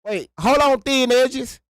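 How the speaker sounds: high, loud, rapid wait wait wait; excited yelling to stop her; urgent interruption. Real, not acted. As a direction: cut her off in a hurry. Cutting in on co-host.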